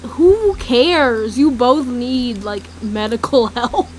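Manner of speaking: loud, drawn-out, half-laughing outburst; mock exasperation. Real, not acted. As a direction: scold them with a laugh breaking in. Mocks her past self, exasperated.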